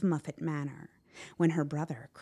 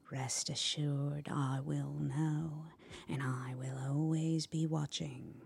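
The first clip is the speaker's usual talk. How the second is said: slow, soft, ominous murmur; silken voice; 5 dB quieter than usual. A narrator's reading. Spider promising to watch the bully, silken menace. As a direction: draw it out as a quiet warning. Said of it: "heard a silken voice in his ear".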